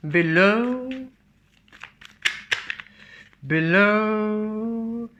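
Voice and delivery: long rising, almost intoned word; drawn-out, high. Real, not acted. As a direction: stretch the last vowel high and hold it. Drawing out a word while reading cards.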